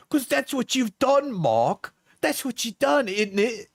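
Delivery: put-on high, whiny parody of the girlfriend; mocking high girly voice. Reads her messages in mock voice.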